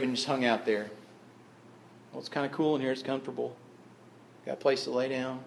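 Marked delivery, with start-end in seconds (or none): 2.1-5.4 very soft, offhand musing; casual murmur. Acted. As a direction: mumble it as if settled in comfortably. Voices Lazarus lazily staying in grave.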